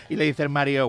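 ordinary talk

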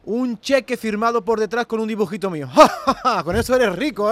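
Dalí riendo fuerte; risa triunfal. Acted. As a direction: carcajada de Dalí satisfecho. Dalí laughs triumphantly. (risa teatral)

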